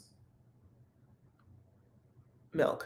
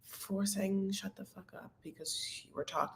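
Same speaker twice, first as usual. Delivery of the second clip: much softer, imitating a meek mumble; quietly mumbled, embarrassed mock apology; sheepish; 15 dB quieter than usual. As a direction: say it like a scolded boyfriend. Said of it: "And he's like".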